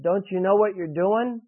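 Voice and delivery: ordinary talk, nothing marked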